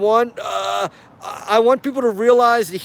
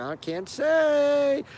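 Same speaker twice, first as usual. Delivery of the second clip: hushed cagey reply; 6 semitones higher, 5 dB quieter, much slower than usual. Retold or imitated. Quoting a cagey officer.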